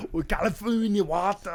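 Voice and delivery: hammed-up Austrian Arnold accent. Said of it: "impersonation of Arnold".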